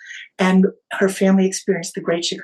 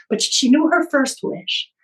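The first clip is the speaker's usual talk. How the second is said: ordinary talk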